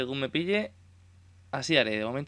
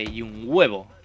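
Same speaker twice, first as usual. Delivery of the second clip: scoffing retort; 3 semitones lower, 6 dB louder, slower than usual. Retold or imitated. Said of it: "Y diré".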